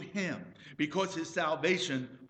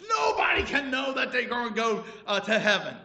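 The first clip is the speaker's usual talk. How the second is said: loud, angry rant; 5 semitones higher, 7 dB louder than usual. Retold or imitated. Husband ranting in the car at his wife, loud. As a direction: rave at the doctor, raising the voice. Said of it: "he started to rant and rave".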